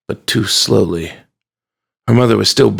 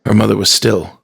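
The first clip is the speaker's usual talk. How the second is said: ordinary delivery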